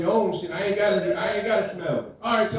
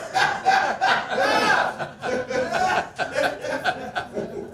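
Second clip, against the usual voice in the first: long wheezing laugh; 9 semitones higher than usual. Real, not acted. Long laugh after bit.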